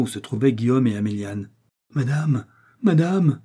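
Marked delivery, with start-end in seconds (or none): 1.9-3.4 higher, distraught call with no real sobbing; through sobs. A narrator's reading. Terrified women bursting in on their lady, through sobs. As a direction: call out in mock-tearful alarm. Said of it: "disaient-elles à travers leurs sanglots".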